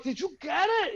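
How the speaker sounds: high, strained anxious question; anxious plea. Retold or imitated. Patient to the doctor still pulling, high anxious plea. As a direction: beg to hear it is over. Patient pleading during procedure.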